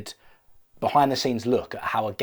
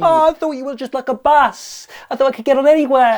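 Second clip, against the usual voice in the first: high, loud pig screech; hammed-up distraught pig; panicked wail; 14 semitones higher, 9 dB louder than usual. Acted pig wails back in quarrel.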